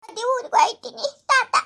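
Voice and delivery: put-on a small child waving off; little girl's goodbye. Daughter calls goodbye to grandma, high child voice.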